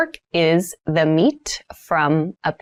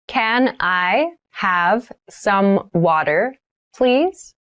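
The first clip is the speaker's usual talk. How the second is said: slow, drawn-out model sentence; slow careful repeat; much slower than usual. Shown as a demonstration. Teacher models asking for water, very slowly. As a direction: spell it out slowly for beginners. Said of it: "vocabulary drill, repeated for learners".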